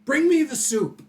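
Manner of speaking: high, loud imperious demand; yelled command. Acted. Villain ordering his servant about, high yell. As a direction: bellow it like a bossy master. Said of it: "He yells at everybody".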